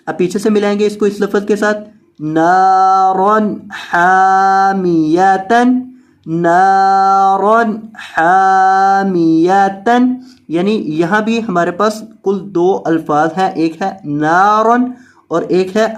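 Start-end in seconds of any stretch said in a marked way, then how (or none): 2.2-10.2 drawn-out Quran reading of each word; stretched tajweed recitation; slow recitation. Shown as a demonstration. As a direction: recite slowly and clearly for the student. Teacher recites Quranic words.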